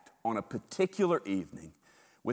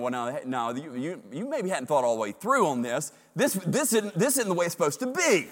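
rising, louder protest in Peter's voice; Peter objecting; 5 semitones higher, 6 dB louder than usual. Acted. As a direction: push back like a know-it-all disciple. Peter correcting Jesus, acted.

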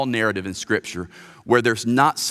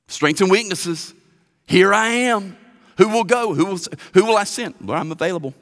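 higher, louder eager offer; eager volunteer; 7 semitones higher, 4 dB louder than usual. Acted. Preacher voices Isaiah volunteering to God, high and eager. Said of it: "Know what he said".

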